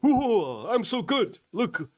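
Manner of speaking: high, smug bragging voice; mock bragging. Host mocking Bongino bragging about himself, high and smug. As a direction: preen like a show-off. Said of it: "brags about".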